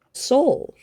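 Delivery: ordinary delivery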